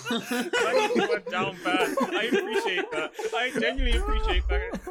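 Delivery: loud, high-pitched talk through real laughter; laughing. Real, not acted. Friend teases podcast mate who admitted being open to it, laughing.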